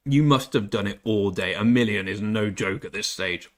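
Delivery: normal talking voice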